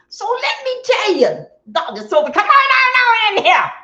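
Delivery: very high, loud holler; calling out. Real, not acted. Streamer breaking off a story to beckon viewers, calling out. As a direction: sing out loudly to pull people in.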